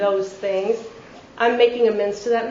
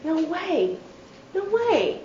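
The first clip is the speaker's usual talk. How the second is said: higher, hushed amazed no way; stunned disbelief; 6 semitones higher, 6 dB quieter, slower than usual. Retold or imitated. Newcomer hears eight years sober, hushed higher disbelief. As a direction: gasp it in disbelief. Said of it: "you're like".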